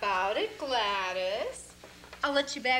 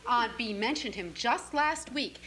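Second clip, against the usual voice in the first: usual speaking voice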